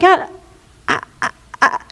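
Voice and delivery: mock short-circuiting stutter; imitating a brain jammed mid-word; confused stammer. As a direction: stall on one syllable, voice falling away. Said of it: "gets confused".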